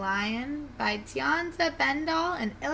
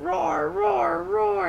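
high-pitched, drawn roars; cub-like higher roaring; young lions roaring; 8 semitones higher, 3 dB louder, much slower than usual. Acted. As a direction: roar the words like lion cubs. Brothers and sisters roar.

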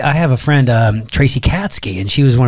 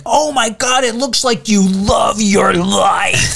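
much higher, slow, drawn-out exclaim; mock gushing; 9 semitones higher, slower than usual. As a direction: gush over the video, stretched out.

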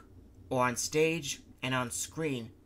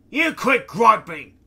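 slow, loud, much higher furious bark; angry snap; 10 semitones higher, 16 dB louder, slower than usual. Acted. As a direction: bite his head off. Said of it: "the engineer snapped".

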